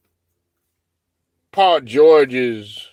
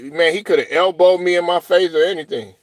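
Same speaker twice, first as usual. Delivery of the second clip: ordinary delivery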